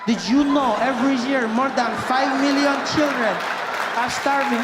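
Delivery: mock-solemn appeal ad read; charity ad voice. Mock ad narrator appealing for starving children. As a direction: put on the charity advert announcer.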